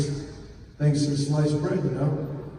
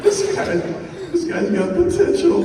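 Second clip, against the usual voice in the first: much higher, louder, laughing through it; freaked-out excitement; 14 semitones higher, 3 dB louder than usual. Retold or imitated. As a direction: squeal over a star recruit. Freaking out over recruit.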